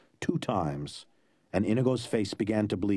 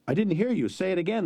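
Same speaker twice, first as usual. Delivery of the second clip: calling out; 9 semitones higher than usual. A narrator's reading. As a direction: call out to the giant ahead. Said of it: "called out".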